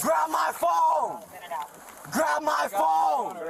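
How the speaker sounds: insistent shout. Real, not acted. Arrestee shouting for phone.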